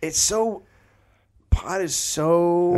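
high, very slow, dazed stoner voice; stoned. Acted. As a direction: stretch it out, lost in the high. Acting stoned Glenn.

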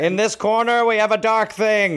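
boxing ring announcer. Acted. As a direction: announce it like a title bout. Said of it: "about to be a boxing match".

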